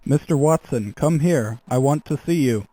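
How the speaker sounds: ordinary talk, nothing marked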